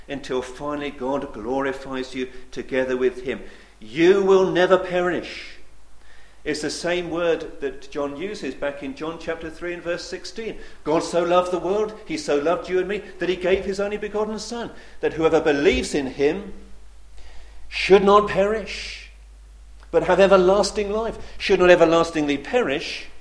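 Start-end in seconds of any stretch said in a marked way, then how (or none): 3.8-5.6 slow, loud proclamation; preaching declaration. Acted. Preacher promising believers they will never perish, loud and slow. 10.8-23.1 much louder, higher gospel proclamation; full-force preaching. Acted. Preacher proclaiming John three sixteen to the congregation, loud.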